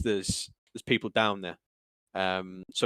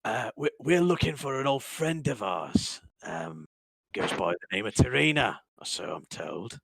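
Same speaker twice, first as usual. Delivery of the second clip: pissed thug; 2 semitones higher than usual. Acted. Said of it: "he still looks pissed ... goes".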